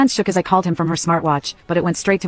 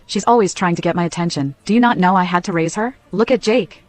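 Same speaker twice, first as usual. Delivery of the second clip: plain reading voice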